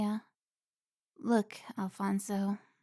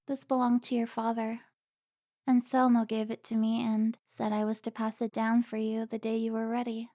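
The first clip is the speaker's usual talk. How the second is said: ordinary talk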